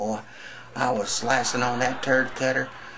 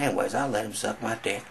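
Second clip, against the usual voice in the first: usual speaking voice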